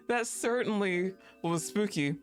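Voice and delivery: ordinary talk, nothing marked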